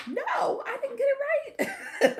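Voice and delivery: high squealing laugh, pitch jumping way up; real laughing squeal at her own mistake. Real, not acted.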